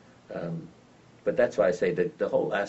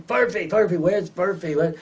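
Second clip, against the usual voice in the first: much higher, louder frantic calling of the dog; 11 semitones higher, 5 dB louder than usual. Retold or imitated. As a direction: call out for the lost dog in a panic. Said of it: "'cause I'd start screaming".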